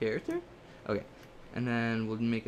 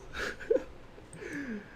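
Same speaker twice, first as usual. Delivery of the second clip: high laugh; 13 semitones higher, 5 dB quieter than usual. Real, not acted. Laugh after the pleading.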